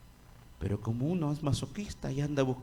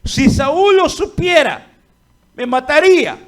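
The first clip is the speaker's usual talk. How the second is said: fearful Samuel; 11 semitones higher, 14 dB louder than usual. Acted. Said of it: "Y dijo Samuel".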